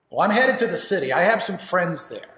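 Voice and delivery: defiant son. Acted. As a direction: answer your father back, loudly. Defiant son answers father.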